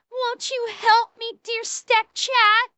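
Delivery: high, slow, full-voiced mock plea; meme damsel plea. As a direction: ham up a drawn-out cry for help. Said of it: "I'll do the end of the meme".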